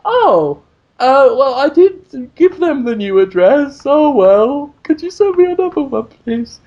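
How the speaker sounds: sugar-coat it with barely hidden fury; sarcastic polite. Retold or imitated. Mock-polite email to publisher hiding his anger. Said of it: "I wanted to show them how angry I was".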